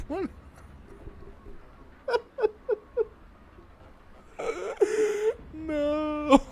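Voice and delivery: high squealing laugh with a laughing no; cry-laugh, barely getting the word out; tearful laughter. Real, not acted. Player cracking up at the twig dialogue, tearful high laugh.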